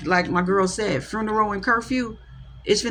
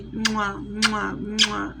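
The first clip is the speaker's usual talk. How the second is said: blown kisses; 5 dB quieter, much slower than usual. Real, not acted. Blowing kisses to viewers.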